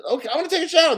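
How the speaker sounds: high-pitched imitation of an excited child; eager kid. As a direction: pipe up like a kid keen to go first. Voicing kids eager to shower.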